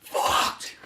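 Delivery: voiceless imitation on the breath; exhaled words. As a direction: mouth it on an exhale, almost soundless.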